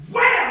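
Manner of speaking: shouted high punch sound effect; loud, high punch sound; loud wham. Acted. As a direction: slam it out like a punch landing. Acting out punching Pastor Dan.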